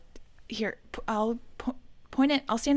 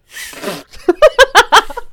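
loud laugh spraying the mic; explosive spit-take laugh; 14 semitones higher, 19 dB louder than usual. Real, not acted. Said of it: "I just spit all over my microphone".

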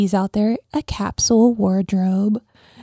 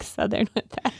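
ordinary talk, nothing marked

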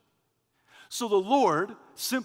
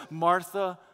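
soft, low, tender reply; calm her gently, unhurried; disarming, affectionate; 7 semitones lower, 6 dB quieter than usual. Acted. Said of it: "in a disarming, affectionate way, simply says".

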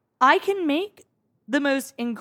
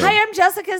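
high, bright server greeting; chirpy server voice; 9 semitones higher, 4 dB louder than usual. Pretend. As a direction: play a cheerful waitress. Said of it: "Go ahead. playing server".

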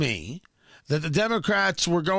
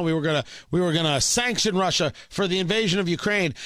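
usual speaking voice

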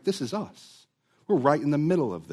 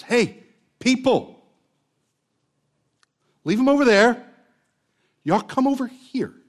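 loud, high calling out to the crowd; Elijah calling out; 10 semitones higher, 5 dB louder than usual. Retold or imitated. Elijah summons the people, high loud call. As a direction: call the people over from across the camp. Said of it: "while Elijah says".

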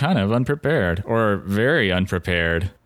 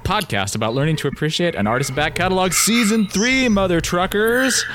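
podcast host voice; 6 semitones higher than usual. Acted. Host opening announcement.

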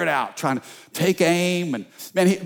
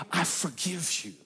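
much softer, tender; tender forgiveness; 10 dB quieter than usual. Acted. Grandma forgiving Johnny, soft and tender. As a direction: say it like a loving whisper.

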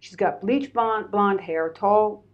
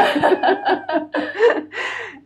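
nervous laugh; 11 semitones higher, 4 dB louder than usual. Real, not acted. Nervous laugh after scared line.

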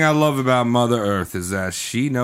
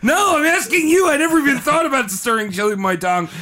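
high, laughing protest; 10 semitones higher than usual. Real, not acted. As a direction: laugh through the words. Laughing, high-pitched teasing.

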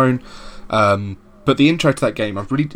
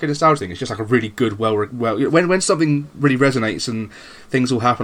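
ordinary delivery

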